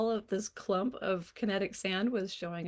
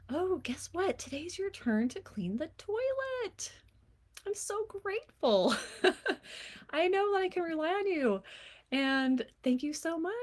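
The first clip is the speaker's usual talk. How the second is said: much higher, bright and teasing, with a laugh; playful tone; 8 semitones higher than usual. Shown as a demonstration. Mom assigning toilet duty to her kid, playful high teasing. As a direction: sweeten the chore ask until it's a joke. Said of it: "like a little playful tone".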